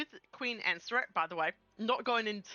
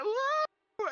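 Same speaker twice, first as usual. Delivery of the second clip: high-pitched mock "whoa"; comic falling whoa, pitched way up; falling yell; 4 dB louder than usual. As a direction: cry out as if dropping off a ledge. Mimics everyone falling.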